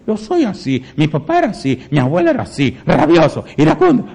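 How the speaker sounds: lightly voiced whiny excuse-maker, climbing high; mock excuse. Preacher mocking a believer's excuses, a hint of whining. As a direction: parody the lazy excuse, rising to a squeak.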